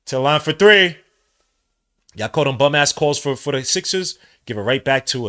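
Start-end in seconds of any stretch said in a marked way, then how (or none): 0.1-1.0 loud emphatic call of three; louder announcer-style repeat. Real, not acted. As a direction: call it out with force.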